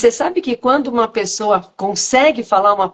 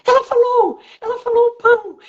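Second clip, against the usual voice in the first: high-pitched, thrilled exclamation; retold excited outcry. Patient announcing the woman's first word, excited high cry. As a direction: cry it out high in amazed joy. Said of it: "o deprimido, falou assim".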